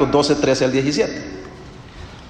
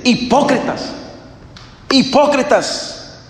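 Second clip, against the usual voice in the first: indignant rebuke; 8 semitones higher, slower than usual. Acted. Jesus rebukes the Pharisees, high and indignant. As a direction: call them out, drawing out each hipócritas. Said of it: "Jesús le gritó".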